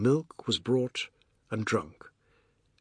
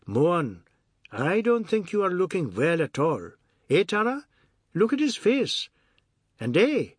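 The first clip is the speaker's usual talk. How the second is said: higher, lilting mock concern; teasing Ajoda; 5 semitones higher than usual. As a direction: tease him, pitch climbing towards a giggle. Ajoda mocks Mohan's looks.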